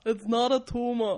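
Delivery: slow, loud Austrian action-star voice; Arnold Schwarzenegger. Retold or imitated. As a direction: growl it like an Austrian action hero. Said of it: "my Arnold Schwarzenegger".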